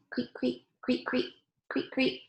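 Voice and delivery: quiet cricket creaks; cricket chirping. Retold or imitated. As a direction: chirp it gently like crickets in grass. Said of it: "The crickets go".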